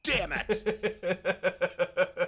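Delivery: loud, high mock curse through a laugh; mock outburst. Drafter losing a player, loud laughing mock curse. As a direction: blow up at the screen, half laughing.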